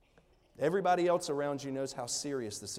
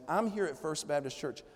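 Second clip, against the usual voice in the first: ordinary delivery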